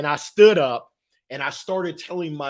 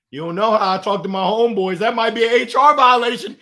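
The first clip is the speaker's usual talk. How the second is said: louder, higher mock alarm; joking protest; 5 semitones higher, 7 dB louder, faster than usual. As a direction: warn him with hammed-up alarm. Joking back to the manager.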